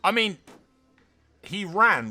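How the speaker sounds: loud, high, broken-off disbelief; flabbergasted outburst; astonished. Real, not acted. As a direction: start and restart in amazement. Astonished by the unexpected win.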